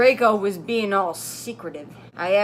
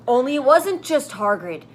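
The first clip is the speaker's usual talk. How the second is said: ordinary talk, nothing marked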